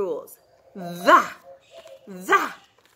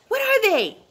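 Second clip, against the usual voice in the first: usual speaking voice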